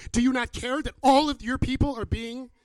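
impassioned loud plea demanding God act; David's indignant demand. Acted. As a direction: cry out in protest, building to a high shout. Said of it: "David says to him".

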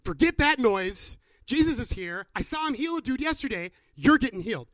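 emphatic, high-energy rallying of a friend; insistent friends. Acted. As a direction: override all doubt with forceful insistence. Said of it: "his friends say".

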